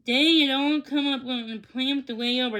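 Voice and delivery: usual speaking voice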